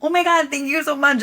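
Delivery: high, gushing thanks; grateful gush. Retold or imitated. Thanking the fan, high and gushing. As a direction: overflow with thanks. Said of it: "And I was like".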